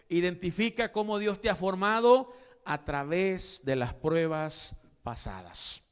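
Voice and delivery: normal talking voice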